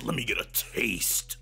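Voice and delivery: low, slow creepy whisper-ish voice; creepy villain. Acted. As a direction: savor it like a creep. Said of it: "That man said".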